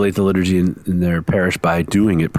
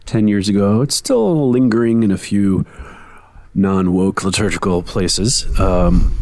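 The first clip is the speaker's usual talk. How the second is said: usual speaking voice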